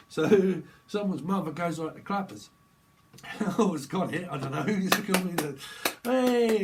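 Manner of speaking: normal talking voice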